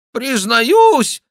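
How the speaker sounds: high, loud nasal jester; jester's nasal mock confession; nasal whine. A narrator's reading. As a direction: whine it loudly through the nose. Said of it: "загнусавил шут".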